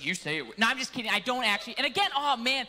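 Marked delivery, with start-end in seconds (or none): 0.0-0.5 low mock voice for the wife; teasing impression of wife, deep-voiced; deeper wife voice. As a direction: imitate your wife, pitched well down. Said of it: "I give her a deeper voice".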